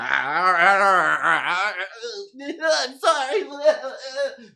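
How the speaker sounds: high, loud, hammed-up blubbering surrender; mock cowering apology. Man called out when wrong, mock pathetic apology. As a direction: back down with exaggerated whimpering, drawing out the end.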